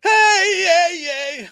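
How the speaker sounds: high, loud cheer; excited cheer for a donation. Real, not acted. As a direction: whoop with joy.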